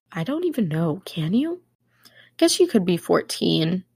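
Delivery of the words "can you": ordinary talk, nothing marked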